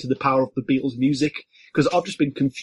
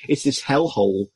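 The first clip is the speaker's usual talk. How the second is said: ordinary talk, nothing marked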